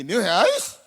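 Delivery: mock panic at a thousand reais; startled stingy husband. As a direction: react like a miser hearing the price.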